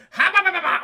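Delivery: loud, high effort noises; comic straining grab noise; mock frantic babble. Imitating frantic grabbing attempts.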